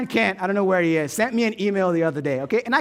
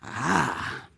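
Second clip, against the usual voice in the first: ordinary delivery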